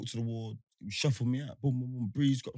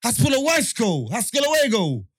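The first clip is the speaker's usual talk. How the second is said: incredulous shout; 9 semitones higher, 10 dB louder than usual. Retold or imitated. Mocking the women's spell to friend, high loud shout. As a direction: shout it in disbelief. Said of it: "I'm saying".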